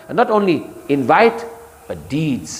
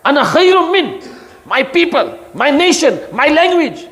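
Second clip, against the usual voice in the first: much higher, proud and pompous; high mocking pride; arrogant boast; 11 semitones higher than usual. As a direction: brag like Shaitan. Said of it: "Shaitan's way".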